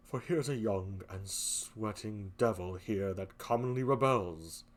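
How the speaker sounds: low, slow suspicious Othello. Acted. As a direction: Othello probing his wife, low and pointed. Othello voiced in play reading.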